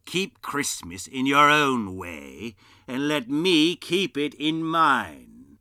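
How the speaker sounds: slow, loud stern warning. Acted. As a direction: lay down the law. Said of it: "the uncle sternly".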